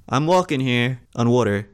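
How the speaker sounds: ordinary talk, nothing marked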